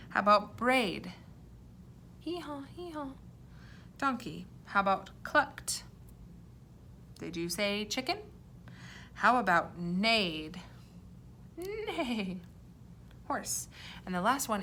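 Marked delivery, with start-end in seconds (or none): 2.2-3.1 high, hushed hee-haw; soft donkey bray. Shown as a demonstration. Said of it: "I am going to say the sound an animal made". 11.6-12.4 high whinnying neigh; horse neigh. Shown as a demonstration. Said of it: "I am going to say the sound an animal made".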